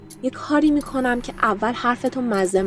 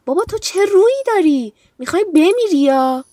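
mock disbelief; 8 semitones higher than usual. Villain mocking amazement.

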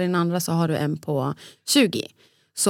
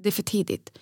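ordinary talk, nothing marked

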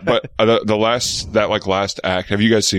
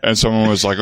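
ordinary talk, nothing marked

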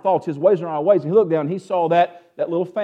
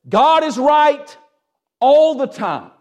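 God is right stretched out slow and higher; preacher hammering home a certainty; slow emphatic declaration; 9 semitones higher, 4 dB louder, much slower than usual. Acted. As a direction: lean on each word, slowly.

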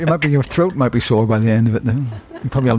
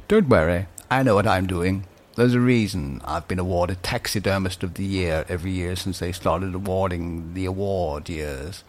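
a hint of a low, quiet taxidermist; lightly voiced proud taxidermist, lower and softer; 6 semitones lower, 5 dB quieter than usual. Voicing taxidermist.

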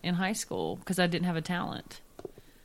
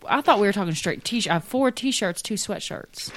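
ordinary delivery

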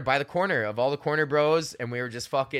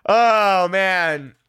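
loud sigh after laughing; 6 semitones higher, 9 dB louder than usual. Real, not acted.

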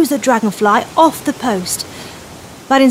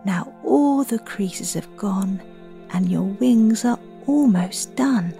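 soft spa whisper; 6 dB quieter than usual. Acted. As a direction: guide the children calmly to breathe. Leads children's breathing meditation.

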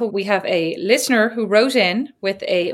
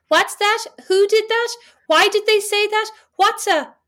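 put-on kids piping up; 13 semitones higher than usual. Mum imitating her children interrupting, high and curious. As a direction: pipe up like a nosy little kid. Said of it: "children pipe up with".

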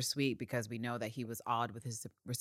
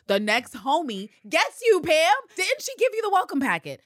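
sarcastic shout; 13 semitones higher, 9 dB louder than usual. Real, not acted. Mocking Pam for not knowing the rules, sarcastic shout. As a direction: yell it at her sarcastically.

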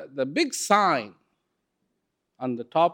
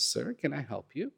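soft shopkeeper; 11 dB quieter than usual. Acted. Shopkeeper greets entering customer.

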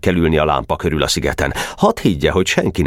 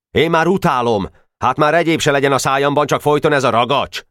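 a higher, complaining schoolboy; grumpy boy; 10 semitones higher than usual. Acted. Barabás complaining about chewing the putty, fed up. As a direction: grumble that he's sick of it.

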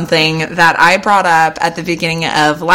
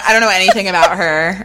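normal talking voice

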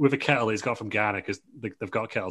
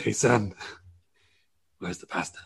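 a hint of a wrestler dad; Rey Mysterio. Father asking his son for pasta, light impression. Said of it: "That's better. That sounds more like him".

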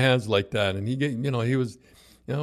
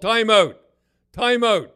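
much higher, louder referee call; called timeout; 10 semitones higher, 5 dB louder, slower than usual. Retold or imitated. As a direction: shout it across the rink.

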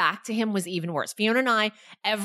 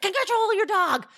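imitated angry runner, pitched up; really angry; 9 semitones higher than usual. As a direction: scold like an outraged stranger. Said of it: "he was really angry".

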